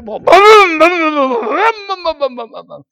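loud, high imitated engine booms; motorcycle booms. Preacher imitating fifty motorcycles at the motel, loud booms. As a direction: boom like revving bikes.

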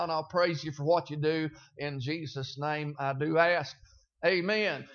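hushed prayer before preaching. Acted. Pastor prays before sermon.

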